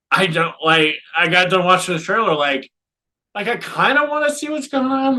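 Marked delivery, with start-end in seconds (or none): 3.3-5.2 high, bemused curiosity; surprised excitement. Real, not acted. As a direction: squeak it out, half amazed. Excited about odd trailer.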